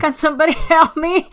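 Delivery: loud, high plea with a laugh; half-laughing shout to passers-by; calling for help. Acted. Woman playing a stranded driver, calling out loudly for help. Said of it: "I'll just go".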